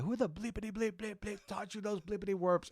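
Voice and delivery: high-pitched mock telling-off; mock angry dad. Retelling his dad catching him cussing, mock angry. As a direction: scold like a mad dad, played for laughs. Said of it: "getting mad 'cause a cuss word slipped".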